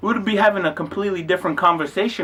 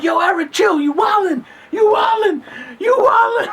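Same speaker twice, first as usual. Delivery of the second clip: very high mock yelling, climbing higher; hammed-up protest; 12 semitones higher than usual. Faking a wrestling match with Eric, hammed-up high protest. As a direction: yelp through a fake scuffle.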